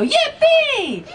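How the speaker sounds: high, gleeful yippee; yippee shout. Shown as a demonstration. Teaching the crowd, a high gleeful yippee. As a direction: whoop it out with glee. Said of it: "and you go".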